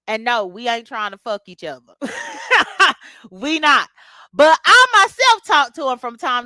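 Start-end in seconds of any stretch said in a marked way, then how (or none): 2.0-3.3 a sudden high burst of laughter. Real, not acted. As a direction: laugh it off, amused. Laughs at own flirting denial.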